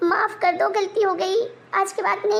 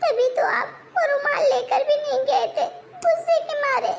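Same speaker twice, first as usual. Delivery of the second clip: whiny complaint; 6 semitones higher than usual. Acted. Wife keeps whining over handkerchief.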